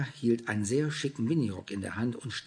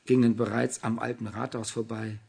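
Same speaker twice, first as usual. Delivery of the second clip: ordinary delivery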